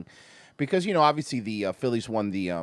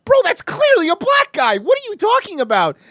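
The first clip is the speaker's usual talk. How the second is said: high, loud exasperated disbelief; incredulous outburst; 14 semitones higher, 7 dB louder than usual. Retold or imitated. Looking at the team photo, shouting in disbelief. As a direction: blurt it out like the answer is obvious. Retelling his outraged reaction to tweet.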